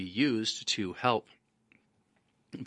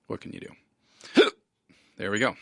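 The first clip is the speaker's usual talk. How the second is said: hiccuping mid-sentence; breath catching on a hiccup; 4 dB louder than usual. Real, not acted. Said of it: "And yes, I have the hiccups".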